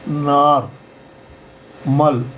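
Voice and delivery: "नार, मल" slowly in two parts, open AA then short mal; syllable by syllable. Shown as a demonstration. Teacher breaks the word up, "नार, मल" as two separate syllables. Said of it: "आ का".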